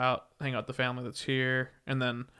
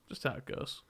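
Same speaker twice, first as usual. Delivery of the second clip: whispered echo; 10 dB quieter than usual. Real, not acted. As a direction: repeat "Just how it goes" in a soft whisper. Whispered echo of own phrase.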